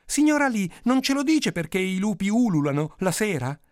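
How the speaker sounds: put-on high, eager child's question; curious child. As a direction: pipe up like a child asking. Child asks the old storyteller. (bambino curioso, voce acuta)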